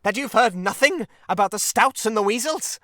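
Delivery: loud, high, incredulous outcry; shouting. A narrator's reading. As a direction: keep shouting, aghast. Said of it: "shouted the rat".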